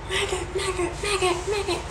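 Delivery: high squeaky maggot through laughter; laughing squeaky taunt. Pretend. Taunting the lady again, high and laughing. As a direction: taunt while cracking up.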